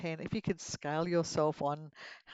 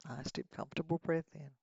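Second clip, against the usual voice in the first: guided meditation; 6 dB quieter than usual. Acted. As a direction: lead them gently into relaxation.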